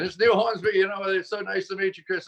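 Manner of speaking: high, chirpy bad British accent; badly faked British accent; Neil Hornsby's British accent. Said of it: "I can't do a British accent".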